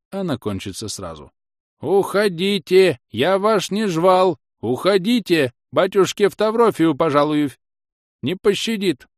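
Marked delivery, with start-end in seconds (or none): 1.8-9.1 higher, louder urgent cries; shouting old man. A narrator's reading. Scene: grandfather yells at his family to hide, urgently. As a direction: order the women away.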